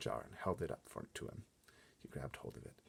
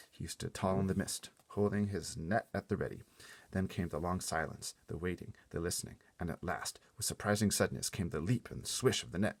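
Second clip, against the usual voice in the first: ordinary delivery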